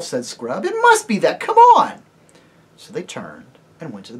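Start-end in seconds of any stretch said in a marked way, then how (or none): 0.7-2.0 put-on high, loud, eager boy's voice; rally them, keen to go; excited schoolboy pushing friends onward; eager urging. Scrub urging the others on.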